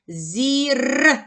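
«Зір» with the р held and rolled; stretched final sound. Shown as a demonstration. Teacher isolating the third sound for pupils, stretched р. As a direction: draw out the last sound of «Зір». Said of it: "Зробимо звуковий аналіз слова зірка".

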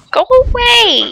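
high, drawn-out wail; pleading yell. Real, not acted. Player telling the monster to go away, pleading yell. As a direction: drag it out high and exasperated.

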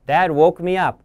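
ordinary delivery, nothing marked

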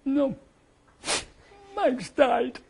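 high, hushed voice of a bereaved relative; grieving relative. Acted. Widow answering the phone, breaking the news, hushed and high. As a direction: answer the phone choked with loss. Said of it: "the voice at the end says".

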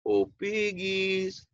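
the gator sweetly luring the piggies; sly gator voice. Acted. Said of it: "said Claude with that big gator smile".

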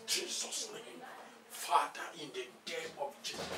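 normal talking voice

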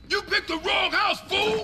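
loud, high yell at an intruder; threatening shout. Acted. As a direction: bark it like you caught a burglar. Gang ambush at house door.

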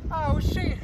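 usual speaking voice

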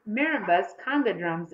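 usual speaking voice

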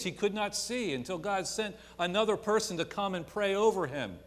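high, loud pulpit preaching; rising revival preacher voice; full-force preaching. Acted. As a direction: preach it out to the back pew.

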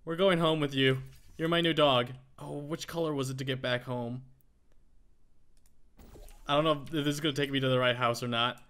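ordinary talk, nothing marked

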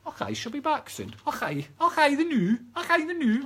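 high, playful exaggerated Scots; put-on Scottish accent. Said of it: "that bloody accent come from".

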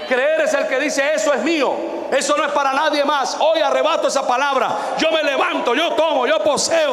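high, loud preacher's declaration; fiery sermon shout; preaching at full force. Real, not acted. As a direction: proclaim it, rising to a shout. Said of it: "preaching faith at full force".

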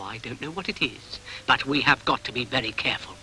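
usual speaking voice

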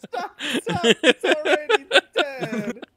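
breathless laughter, slow; loud, high laughing plea. Real, not acted. Laughing fit.

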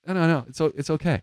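high-pitched, hurried-to-reassure refusal; polite waving-off. Acted. Acting out declining overly polite drivers.

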